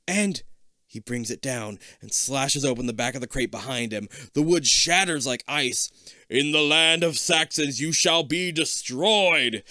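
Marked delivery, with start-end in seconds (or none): 6.3-9.6 loud, slow booming villain; booming evil knight. Acted. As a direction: boom it out like a dark knight. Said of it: "His voice booms over you".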